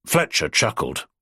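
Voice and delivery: ordinary delivery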